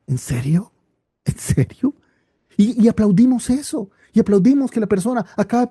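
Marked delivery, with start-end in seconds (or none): 0.1-0.7 low, quiet and doubting; first take, doubting under the breath; incredulous. Acted. Mocking a widowed pastor's claim. 1.2-1.9 whispered high, incredulous; second take, a high stunned whisper; whispered disbelief. Acted. Mocking a widowed pastor's claim.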